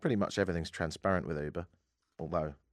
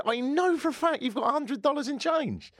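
mock high voice. Retelling confronting the cab driver.

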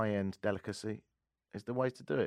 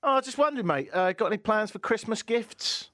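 bright high ad voice; hammy radio ad pitch; ad read; 13 semitones higher, 4 dB louder than usual. Acted. Starts ad read.

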